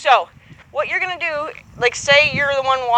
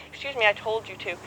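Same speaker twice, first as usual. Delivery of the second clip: firm but quiet; 3 semitones lower, 9 dB quieter than usual. Shown as a demonstration. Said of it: "I'd wanna be more like".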